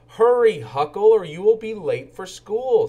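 loud, high urgent call. Acted. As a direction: hurry him along from afar. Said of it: "said Officer Murphy".

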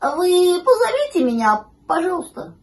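high, timidly polite puppy plea; puppy plucking up courage. A narrator's reading. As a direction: start loud and high, soften on the please. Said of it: "щенок набрался храбрости".

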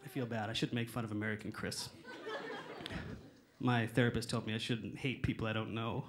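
low, soft, sheepish admission; quiet mock remorse; subdued confession. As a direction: drop right down as if contrite. Mock confession after mocking someone.

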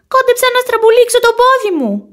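high whiny complaint; whimpering. A narrator's reading. Boy whining to his friend after nearly twisting his foot, whimpering. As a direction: whine about the hurt foot. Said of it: "κλαψουρίζει".

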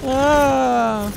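mock panic. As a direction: play up the fear of another dropship. Mock panic, new dropship.

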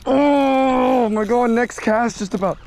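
high, loud, drawn-out cry of joy; overjoyed slow shriek at a strike; ecstatic cry. Real, not acted. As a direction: cry out slowly in pure delight. Fish strikes, cry heard.

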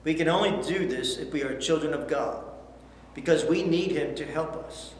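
ordinary delivery

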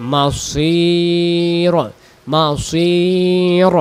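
মাসির with the vowel held very long; মাসির drawn out in a slow chant. Shown as a demonstration. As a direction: stretch মাসির out slowly. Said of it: "teacher demonstrates elongation".

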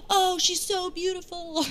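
high, slow adoring audience voice; mock gushing. Comic voicing her audience admiring her, mock gushing. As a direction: coo it in put-on admiration.

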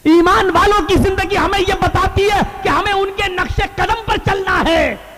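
high, ringing sermon delivery; full-force preaching. Real, not acted. Preacher urging believers to follow the faithful, full-force preaching.

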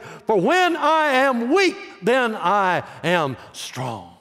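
very high then drawn out slow; slow dramatic climax. Acted. Preacher landing the verse's climax for the church, slow and drawn out. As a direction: stretch out the final line for effect.

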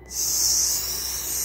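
long hissing s; drawn-out s like a snake. Real, not acted. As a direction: stretch the s like a snake hiss. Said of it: "Sorry, I sounded like a snake for".

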